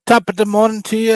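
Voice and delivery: hammy British-Irish greeting, fast; mock British cheer; bad British accent. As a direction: try a British accent and miss. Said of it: "I can't do a British accent".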